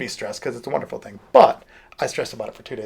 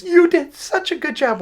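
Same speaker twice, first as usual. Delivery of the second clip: put-on high, slow girlish praise; gushing young girl; 14 semitones higher, slower than usual. Girl praising her bandmate, high imitated gush. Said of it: "one of the girls like".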